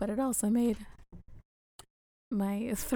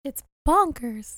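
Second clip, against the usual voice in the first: fake voice; 4 semitones higher, 6 dB louder, slower than usual. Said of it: "That was a fake voice".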